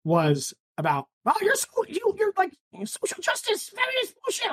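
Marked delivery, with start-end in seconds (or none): 1.2-4.5 high-pitched mocking imitation; mock complaint. Mimicking someone griping about social justice, mocking. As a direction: mimic a whiny objector in a high voice.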